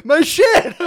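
high, loud wail; hammed-up outraged lament; mock anguish. Wizard seeing his stuff blown up, mock-anguished.

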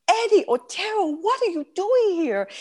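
high, thrilled voice retelling a greeting; acted squeal of astonished delight; surprised greeting. Retells surprised greeting of old friend.

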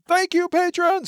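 high, loud and bright thanks; excited shout-out. Real, not acted. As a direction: cheer it out to the patrons. Exuberant thanks to patrons.

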